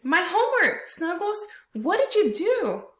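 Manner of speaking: high, loud wail of mock dismay; acted dismay. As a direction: cry out to the dog in dramatic disbelief. Acting out Lexi's dismay on knees.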